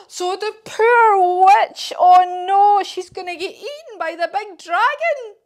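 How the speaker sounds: loud, high storyteller's dismay; mock horror. A narrator's reading. Storyteller fears for the poor witch, hammed-up alarm. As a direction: wail it with exaggerated alarm for children.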